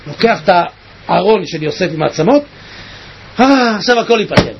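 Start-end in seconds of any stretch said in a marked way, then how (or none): none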